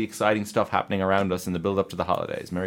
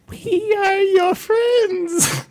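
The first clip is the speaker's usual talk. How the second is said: slow, very high, louder wail; 9 dB louder, much slower than usual. Pretend. Creepy bit turns to wail.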